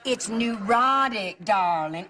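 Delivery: neurotic drawn out slowly, every syllable crisp; over-enunciated. Shown as a demonstration. Aunt corrects niece's pronunciation, slow theatrical enunciation. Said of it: "Enunciation is everything".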